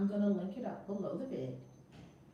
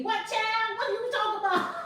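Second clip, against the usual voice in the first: put-on higher, louder bewildered old grandmother; baffled grandma; 13 semitones higher, 8 dB louder than usual. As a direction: play grandma who doesn't get it.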